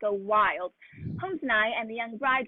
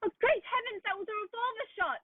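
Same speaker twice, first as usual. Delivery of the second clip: sudden high gasp of alarm; jolted by a gunshot, cry it out; shocked, breathless exclamation; startled alarm; 8 semitones higher than usual. Acted. Gunshot heard from the house.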